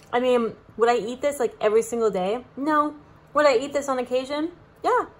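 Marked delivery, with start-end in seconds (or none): none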